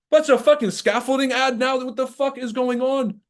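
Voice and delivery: high, loud disbelieving yell; incredulous rant. Real, not acted. Stunned by a scaffolding ad, shouting in disbelief. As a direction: blow up at the absurdity.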